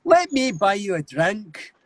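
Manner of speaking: high, slow, guttural Scottish; Scottish accent. Shown as a demonstration. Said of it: "say a sentence in a Scottish accent".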